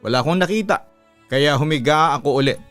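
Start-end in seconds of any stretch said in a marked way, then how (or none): none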